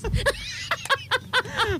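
carcajada aguda. Real, not acted. Ríe del refrán.

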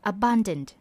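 ordinary delivery

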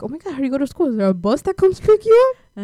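high, loud, climbing excitement; wide-eyed mock amazement; naive eager questioning. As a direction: build to a hammed-up squeal of wonder. Acts out naive question to cousin.

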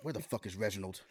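low, soft, put-on clerk voice, a touch mocking; mock store clerk. Store clerk muttering to a coworker, low put-on voice. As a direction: clerk grumbling about Reginald.

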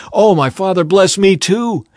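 high, loud begging cry; desperate plea. A narrator's reading. Esau begs his father to bless him too, crying out in a plea. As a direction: plead at the top of the voice, drawing out the end. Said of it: "Esau pleaded".

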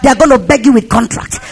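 rhythmic declarations climbing to a long, high final cry; preacher working up the congregation; fired-up preaching. Real, not acted.

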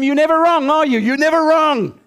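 high, loud sarcastic jab; acted angry wife. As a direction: throw it loudly at a stubborn husband. Wife snapping in acted quarrel.

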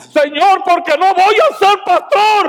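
high, loud cry to the Lord; retold yell. Pastor retelling how he yelled at God, loud and high. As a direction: shout the protest up at God. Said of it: "Y grité".

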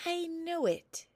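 high, slow, nervous voice. Acted. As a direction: squeak it out timidly. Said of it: "said Rep-a-tep-tep nervously".

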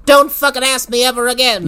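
high put-on granny threat; mock-threatening granny. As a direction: warn them off as a rifle-toting granny. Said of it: "She pumps the rifle real fast".

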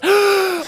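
high imitated scream of joy; freaking-out scream. Imitating the trainspotter seeing the train, excited scream. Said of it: "He's freaking out about it".

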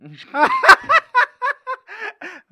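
gargalhada alta e aguda. Real, not acted. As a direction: explode numa risada aguda. Reação à piada do programa. (gargalhada estridente)

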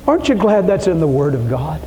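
ordinary talk

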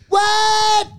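very high, drawn-out comic squeal; Aziz Ansari. Acted. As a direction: yelp it like a caricatured comedian. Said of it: "See, I can do it".

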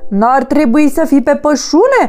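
high, loud crow voice, croaking out; loud cawing crow. A narrator's reading. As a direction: caw as loud as a crow can. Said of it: "croncăni cât putu de tare".

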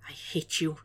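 very soft, nearly whispered; small, quiet voice. A narrator's reading. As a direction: say it hushed, into someone's chest. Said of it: "Will whispered against his chest".

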